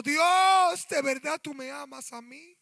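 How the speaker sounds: high, loud plea to God; crying out. Acted. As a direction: cry it upward, pleading. (clamor alto y suplicante)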